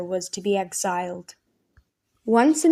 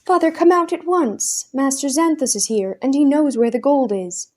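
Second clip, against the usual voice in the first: much higher, loud urgent call; 6 semitones higher, 4 dB louder than usual. A narrator's reading. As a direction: shout through the door to father. Calling father from his room.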